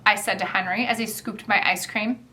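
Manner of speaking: plain reading voice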